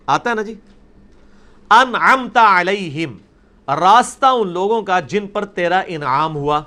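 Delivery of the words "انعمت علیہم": انعمت drawn out slowly, each syllable clear; slow Quranic recitation. Shown as a demonstration. Teacher reciting انعمت علیہم slowly for students. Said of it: "انعمتا ہے، تا کے اوپر کیا ہے؟ زبر".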